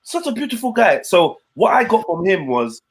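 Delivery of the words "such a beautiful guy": higher, eager mock gushing; a hint of the guy eagerly insisting; imitating the guy. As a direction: brush off the correction, gushing on. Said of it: "he was just on the".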